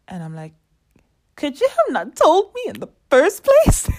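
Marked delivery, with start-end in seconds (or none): 1.4-3.9 much higher, loud indignant retort; mad outburst. Retold or imitated. To the shop staff who ran out of gas, furious high outburst. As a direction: let the outrage burst out at them. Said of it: "I was so mad".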